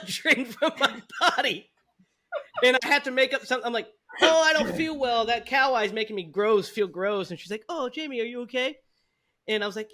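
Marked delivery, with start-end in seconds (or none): 4.2-7.3 higher, louder whiny excuse; boy faking nausea to the teacher; faked sickness. 7.7-8.8 higher, lightly voiced concerned teacher; teacher asking the student if he is okay; caring teacher.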